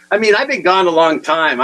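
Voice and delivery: ordinary talk, nothing marked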